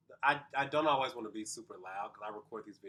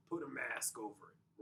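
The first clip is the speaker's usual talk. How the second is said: softer airy false voice; masked voice; 7 dB quieter than usual. Shown as a demonstration. Coach contrasting real voice and the mask. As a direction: let the mask cover the sound.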